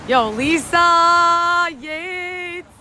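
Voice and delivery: very slow, high, stretched-out name call; hype call. Real, not acted. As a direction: drag the name out to cheer her on. Hyping a stream gifter.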